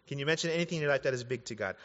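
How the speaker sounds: rapid, casual repeat of the question; quick recap. Retold or imitated. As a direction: rattle off "Can you mention anything" fast. Rattles off the quote again.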